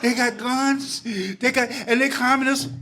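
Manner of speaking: put-on high, thrilled kid's voice; excited teenage self. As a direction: gush like an awestruck fifteen-year-old. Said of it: "And I was like".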